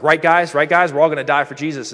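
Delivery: acting out an overkeen rallying cry; mock rallying. Peter looks around at the other disciples, mock rallying.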